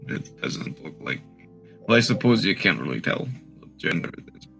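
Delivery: normal talking voice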